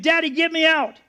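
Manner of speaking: put-on high, urgent child's plea; imitated terrified scream. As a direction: cry out like a trapped small child. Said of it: "he's screaming".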